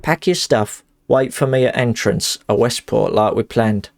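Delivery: game character's lines in Cockney; put-on Cockney with stray northernisms; Cockney accent. Streamer voicing a letter to Clarice, Cockney accent. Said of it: "my Cockney accent".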